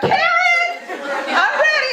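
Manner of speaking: high, far-carrying call from another room; a mock holler, high and sing-song. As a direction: call out to your daughter from the bath. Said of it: "she hollers out".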